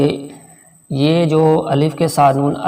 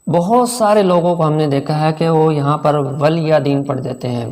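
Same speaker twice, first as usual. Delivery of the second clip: ordinary talk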